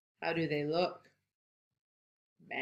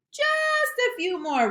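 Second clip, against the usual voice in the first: high wheedling voice; high pleading Rabbit; 4 dB louder than usual. Acted. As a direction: beg for more carrots. Rabbit insists on carrots.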